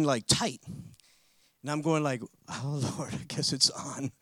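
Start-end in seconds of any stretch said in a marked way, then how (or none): 2.5-4.1 bracing inner thought. Retold or imitated.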